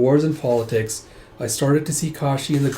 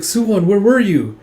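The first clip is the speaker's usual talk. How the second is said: high, louder motherly exclamation; worried exclamation; 8 semitones higher, 7 dB louder than usual. Acted. Mother questions her wandering son, worried and exclaiming. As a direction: call out to a missing child.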